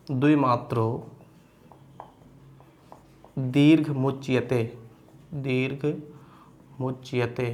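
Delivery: slow chanted recitation of a Sanskrit rule; sutra recitation. Shown as a demonstration. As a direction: recite the sutra slowly, word by word, with pauses. (धीमे स्वर में सूत्र पाठ)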